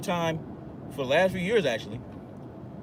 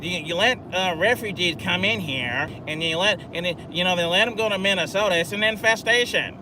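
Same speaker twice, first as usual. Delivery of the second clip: a lightly put-on voice, mildly higher, sneering; 3 semitones higher, 3 dB louder than usual. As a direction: imitate him with a touch of scorn. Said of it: "This kid was saying".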